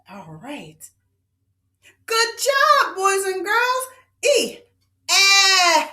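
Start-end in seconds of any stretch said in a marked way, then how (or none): 5.1-5.9 EH held long, higher and louder; a sustained, raised EH; stretched short E. Shown as a demonstration. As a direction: stretch EH out steadily as in bet. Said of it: "Good job stretching that E".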